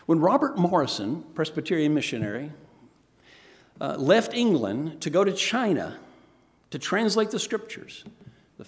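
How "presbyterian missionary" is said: normal talking voice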